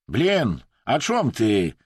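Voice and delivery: loud, exasperated question; irritated outburst. A narrator's reading. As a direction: snap out of patience. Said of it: "nie wytrzymał podirytowany Wadim". (podirytowany, głośny wybuch)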